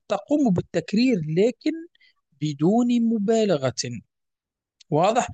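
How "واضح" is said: usual speaking voice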